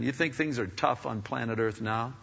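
normal talking voice

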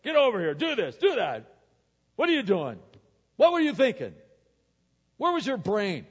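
loud, high barked orders; hammed-up angry father, firing demands; rough, gruff. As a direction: play a gruff dad chewing out his kid. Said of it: "We're rough, we're gruff".

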